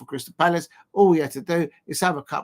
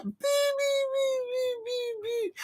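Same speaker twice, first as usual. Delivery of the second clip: put-on high, whiny baby bleating, much higher; mock the manager as a crying baby; mock crybaby whine; 5 dB quieter, slower than usual. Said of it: "he's like".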